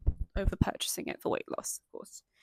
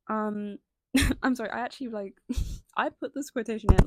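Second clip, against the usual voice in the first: laughing; 8 dB louder than usual. Real, not acted. Laughing at her own quote.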